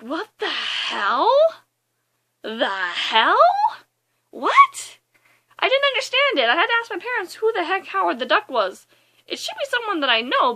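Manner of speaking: stretched questions rising into high-pitched protest; bewildered disbelief. Retold or imitated. Bewildered by surprise cameo.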